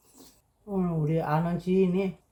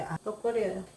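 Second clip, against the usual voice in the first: ordinary talk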